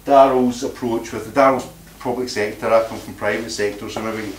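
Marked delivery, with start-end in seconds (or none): none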